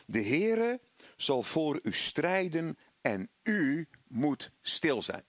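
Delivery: slow, weighty scripture quote, word by word; solemn scripture. Acted. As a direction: let each word of the promise land slowly. (plechtig en langzaam citeren)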